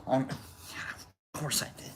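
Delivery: a sly whispered admission; whispered confession. Real, not acted. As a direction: whisper it, unvoiced and slow.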